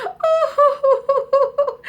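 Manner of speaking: high, loud mock sobbing; hammed-up boo-hoo; crying princess. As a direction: wail like a heartbroken child. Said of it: "said the princess".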